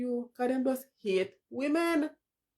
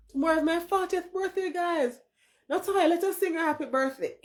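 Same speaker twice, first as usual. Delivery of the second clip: high, bright read of the message; playfully voicing a viewer's message; mock chat voice; 8 semitones higher, 3 dB louder than usual.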